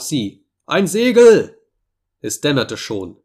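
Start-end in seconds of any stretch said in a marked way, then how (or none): 0.7-1.6 high, loud lookout's yell; shouted cry. A narrator's reading. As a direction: yell the sighting out at the top of your voice. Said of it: "gleich darauf schrien auch". (lauter, hoher Schrei)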